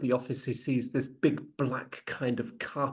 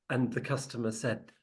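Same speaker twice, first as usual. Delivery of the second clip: ordinary talk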